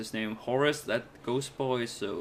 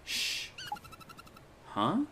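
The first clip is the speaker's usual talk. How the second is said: ordinary talk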